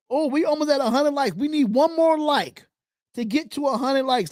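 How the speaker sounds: rally the chat to like; excited hype. Real, not acted. Streamer urging viewers to hit a hundred likes, excited.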